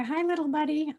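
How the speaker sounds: high-pitched little greeting; cooing to a pet. Real, not acted. Greeting a hummingbird mid-chat, cooing. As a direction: coo it to a tiny visitor.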